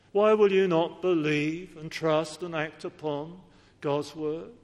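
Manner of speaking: ordinary talk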